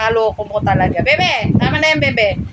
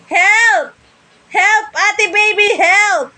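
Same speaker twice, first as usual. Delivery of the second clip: pretend cry for help; 8 semitones higher, much slower than usual. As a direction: cry out as if falling, all in fun. (malakas na kunwaring sigaw ng tulong)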